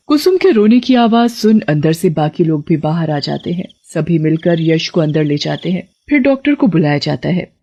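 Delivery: plain reading voice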